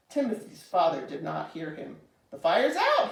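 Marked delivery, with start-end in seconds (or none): 2.3-3.1 high, loud exclamation; astonished cry. A narrator's reading. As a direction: cry out the news. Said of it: "he cried".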